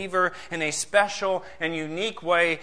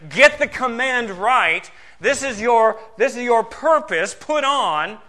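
loud, raised pulpit exhortation; urgent preaching; 5 semitones higher, 6 dB louder, slower than usual. Real, not acted. Preacher urging believers to get the command right, urgent preaching. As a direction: press the command home forcefully.

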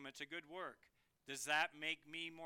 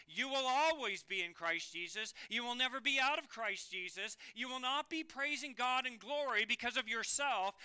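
louder, higher, insistent pulpit delivery; emphatic preaching; 3 semitones higher, 4 dB louder than usual. Real, not acted. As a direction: preach it with rising force. Preacher drives assurance home to congregation.

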